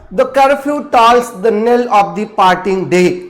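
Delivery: slow iambic reading of "The curfew tolls the knell of the parting day"; slow recitation. Shown as a demonstration. Teacher reciting Gray's Elegy line, slow and measured. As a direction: read the line slowly to show its beat. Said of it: "Thomas Gray की elegy की पहली ही line है".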